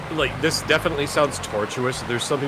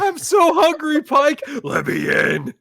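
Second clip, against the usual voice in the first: hungry vampire voice; 13 semitones higher, slower than usual. Pretend. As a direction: plead to be let in, starving.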